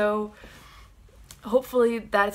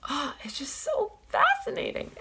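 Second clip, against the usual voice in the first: much higher, swooning delight; enthralled gush; 13 semitones higher than usual. Real, not acted. Fan gushing to viewers about dinosaurs, high swoon. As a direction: sigh it out in awe.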